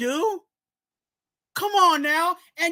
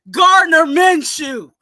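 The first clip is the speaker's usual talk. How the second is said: loud, slow name reveal; host reveals who gets the weekly call-out; big reveal shout; 2 semitones higher, 9 dB louder, slower than usual. Real, not acted. Reveals the segment's target.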